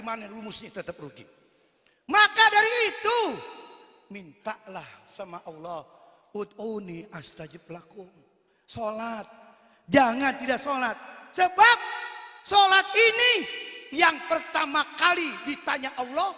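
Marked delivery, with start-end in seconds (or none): 2.1-3.2 loud preaching. Real, not acted. Preacher raising voice. 9.9-16.3 raised, warning preacher voice; loud preaching. Real, not acted.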